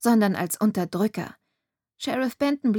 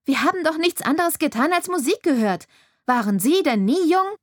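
much higher, louder, exasperated plea; indignant outcry; 7 semitones higher, 4 dB louder than usual. A narrator's reading. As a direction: throw the question at him in disbelief. Girl pleads angrily with sheriff. (Mädchen empört sich beim Sheriff, viel höher und lauter)

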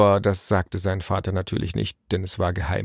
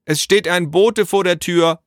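raised, higher calling voice; calling into the house; 10 semitones higher, 5 dB louder than usual. A narrator's reading. Naru announces a messenger to father inside, calling out. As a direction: holler it to someone indoors. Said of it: "rief Naru ins Haus".

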